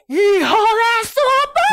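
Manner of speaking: high, loud, slow furious curse; outburst. Retold or imitated. Betrayed woman at the door, furious outburst. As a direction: explode at the news. Finding out he moved in.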